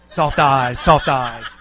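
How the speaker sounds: loud echo with a laugh in it; mock the reminder back, punching both words out loudly; loud mimicking. Husband parroting his wife's reminder back, loudly mimicking.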